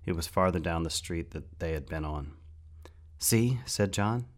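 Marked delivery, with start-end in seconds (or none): 3.2-3.6 a hint of a higher boy's voice, pleased. John to his friend while hiding, lightly voiced and pleased.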